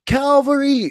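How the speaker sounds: high cry of alarm; mock alarm. Mocking raiders spotting Muhammad's band, a high alarmed cry. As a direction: shout it like a startled scout. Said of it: "and they're like".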